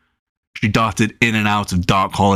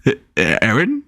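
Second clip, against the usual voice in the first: stuttering little brother; 3 semitones higher than usual. A narrator's reading.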